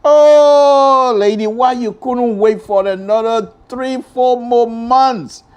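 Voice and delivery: loud pleading lament, last words slow. Real, not acted. Host laments to the late justice, loud slow pleading. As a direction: plead with the departed. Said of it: "big blow to us".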